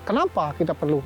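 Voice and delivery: ordinary talk, nothing marked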